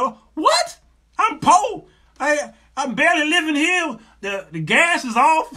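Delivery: high, loud comic outburst; hammed-up indignant protest; mock panic. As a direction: re-enact a broke husband's alarm. Retells panic at pregnancy news.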